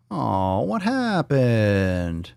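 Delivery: very slow, stretched-out whine; long, moaning "what happened"; drawn-out mock dismay. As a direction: drag the words out in playful disappointment. Stretched mock dismay at failed button.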